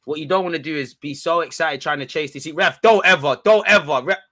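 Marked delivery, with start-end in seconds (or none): 2.5-4.2 loud, higher protest at the ref; yelling at ref. Real, not acted. Fan yelling at the ref over a foul, loud. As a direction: shout it at the referee.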